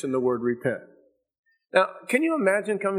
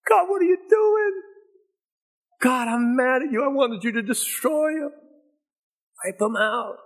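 furious whine at God; mad at God; 10 semitones higher than usual. Retold or imitated. Jonah yelling at God for sparing the city, angrily. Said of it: "Jonah got mad at God".

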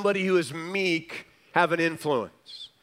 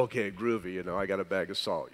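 underwhelmed soldier; 9 semitones lower, 9 dB quieter, much faster than usual. Pretend. Voices an underwhelmed Roman soldier.

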